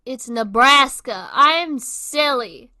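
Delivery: loud, slow self-mocking cry; loud exclaiming. Real, not acted. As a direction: declare it loudly, laughing at yourself. Realizes her mistake, loud.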